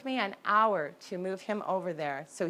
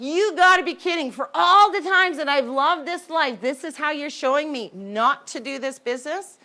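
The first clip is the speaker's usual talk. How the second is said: loud, higher furious yell; yelling at God; 5 semitones higher, 8 dB louder than usual. Retold or imitated. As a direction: scream your fury up at the sky. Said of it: "yelling and screaming at the top of my lungs".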